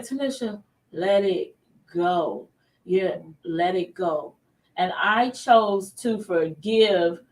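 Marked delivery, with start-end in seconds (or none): 0.0-4.4 calming self-talk. Retold or imitated. Wife talking herself out of anger, slow and soothing. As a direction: talk yourself into letting go, slowly. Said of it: "Then I said".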